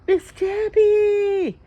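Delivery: drawn-out high call across a hallway. Retold or imitated. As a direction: sing out her name from a distance, slow and high. Tank calling out across hallway.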